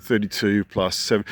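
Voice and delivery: ordinary delivery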